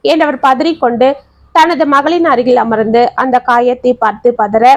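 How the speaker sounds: plain reading voice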